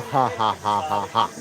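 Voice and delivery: slow low villain cackle; evil laugh. Shown as a demonstration. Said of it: "Saya ketawa jahat".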